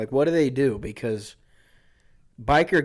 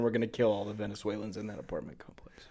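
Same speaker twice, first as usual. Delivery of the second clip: hushed menacing whisper; whispered threat; 3 semitones lower, 8 dB quieter than usual. Retold or imitated. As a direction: breathe the threat softly. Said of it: "So basically they were saying".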